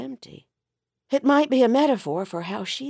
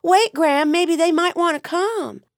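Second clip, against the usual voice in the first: high, loud call after someone; 10 semitones higher, 6 dB louder than usual. Acted. Wife calling after her husband, high and loud. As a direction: call him back eagerly.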